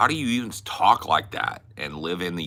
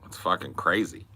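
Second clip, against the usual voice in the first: ordinary delivery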